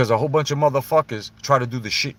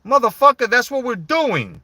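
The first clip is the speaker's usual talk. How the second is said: loud, higher angry snap back; angry retort; 10 semitones higher, 5 dB louder than usual. Real, not acted. Ranting at Triple H, a loud angry retort. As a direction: throw it back at him with outrage.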